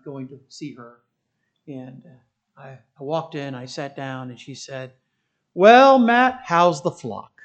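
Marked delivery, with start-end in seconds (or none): 5.5-7.3 loud, drawn-out ribbing hello; high, loud start easing down; mock hearty greeting. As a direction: imitate a barber calling out a teasing greeting. Imitating barber's teasing greeting.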